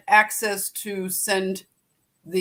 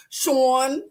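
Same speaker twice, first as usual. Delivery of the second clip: Sean said as "Shawn" in a light Philly accent; friendly Philadelphia greeting, Sean as "Shawn"; 3 semitones higher, 3 dB louder than usual. Shown as a demonstration. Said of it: "like a Philadelphian when I see Sean".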